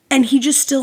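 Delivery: ordinary talk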